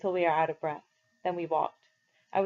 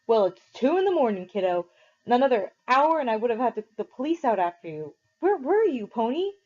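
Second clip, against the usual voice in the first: louder and higher anger building to a peak; rising anger; 5 semitones higher, 5 dB louder than usual. A narrator's reading. Derry scolding Pony at two in the morning, anger rising. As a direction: let the fury climb. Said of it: "His voice was rising".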